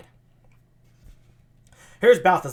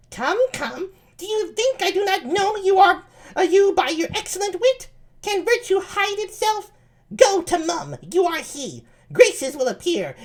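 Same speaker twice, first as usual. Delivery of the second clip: much higher falsetto lady voice; high-pitched Ursula. Acted. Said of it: "I need a high-pitched voice".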